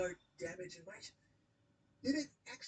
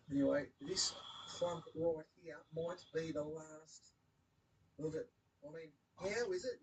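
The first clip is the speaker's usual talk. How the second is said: ordinary talk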